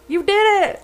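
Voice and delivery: high, loud cheer, a voice unlike her own; cheering on a crying man. Retold or imitated. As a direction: cheer him on like a proud friend. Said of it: "it's like".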